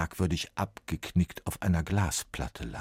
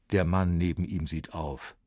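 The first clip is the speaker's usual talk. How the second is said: plain reading voice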